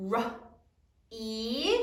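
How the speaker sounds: R, E as two isolated sounds; short "R", pause, long rising "E"; sounding out. Shown as a demonstration. Teacher models spelling sounds for class, sounding out. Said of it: "Sound it out".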